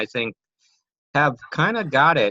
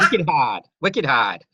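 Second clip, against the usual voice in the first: Boston slang with R-less "hard"; Boston accent; slower than usual. Shown as a demonstration. Co-host showing off Boston slang, Boston accent. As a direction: say "hard" as "hahd", Boston style. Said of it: "something about Boston".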